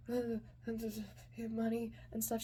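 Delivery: soft, halting mumble; nervous stammer. Retold or imitated. Kid offering money to the woman, nervous stammer. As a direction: stumble over it shyly.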